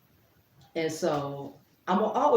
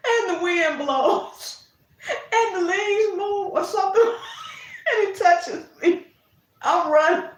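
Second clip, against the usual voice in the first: high, breathless giggling retelling; can barely get it out for laughing; laughing hard at her own story; laughing through it; 12 semitones higher, 6 dB louder, slower than usual. Real, not acted. Laughing while retelling garden scare.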